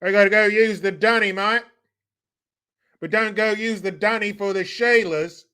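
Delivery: high-pitched Aussie caricature; mock Australian accent. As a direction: put on a broad, hammed-up Australian. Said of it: "Australian, the toilets are dunny".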